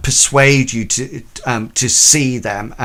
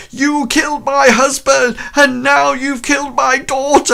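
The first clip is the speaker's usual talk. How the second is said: much higher, shouting, out of her mind; insane shouted accusation; 13 semitones higher than usual. Acted. Grieving widow turning on Ducré, shouting high and unhinged. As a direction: hurl it at her like a madwoman. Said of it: "she just looks absolutely, um, insane".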